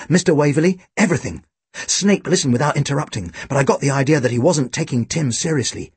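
ordinary delivery, nothing marked

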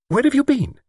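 high-pitched, sharp question. A narrator's reading. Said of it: "she said sharply".

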